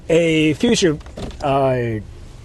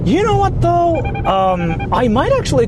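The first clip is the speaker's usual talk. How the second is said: sudden excitement; 12 semitones higher than usual. Real, not acted. Excited sudden idea mid-stream.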